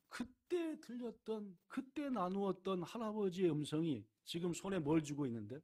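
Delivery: ordinary talk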